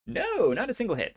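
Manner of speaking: high, quick cry of dismay; high-pitched disappointed outburst. Real, not acted. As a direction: react to a missed roll, voice jumping up. Missed roll, dismayed reaction.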